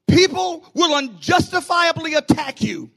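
loud, steady sermon push; driving preaching. Acted. Pastor warning the church, driving preaching. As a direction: build it over the organ.

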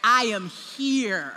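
low, slow stern mother's command; mama voice. Retold or imitated. Mother ordering her caught son downstairs, low slow stern command. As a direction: lay down the law like a mama who don't play. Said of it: "now put my mama voice on".

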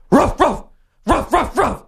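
high, loud yapping ruff ruff; dog bark imitation. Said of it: "it's like".

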